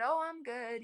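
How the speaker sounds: little voice hiding that she's not okay. Retold or imitated. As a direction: pretend everything is okay in a tiny voice. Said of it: "with my little voice".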